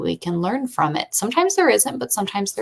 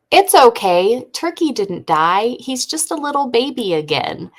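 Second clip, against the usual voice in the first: put-on higher-pitched kid voice, starting loud; little girl consoling; 6 semitones higher, 4 dB louder than usual. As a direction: reassure like a five-year-old. Retold five-year-old consoling the chickens.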